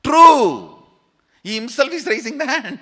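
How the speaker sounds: high-pitched, very loud outburst; loud exclamation. Real, not acted. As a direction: call it out high and loud to the crowd. Calls out a show of hands.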